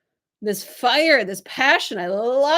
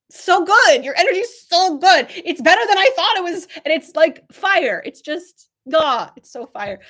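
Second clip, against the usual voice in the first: gushing excitement; 6 semitones higher, 6 dB louder than usual. Real, not acted. Gushing praise of client's energy.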